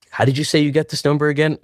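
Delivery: quick, high-pitched imitation of a wary callee; puzzled mock questioning. Dancer asking a stranger who phoned him, puzzled and quick. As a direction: rattle off the question, pitched well up.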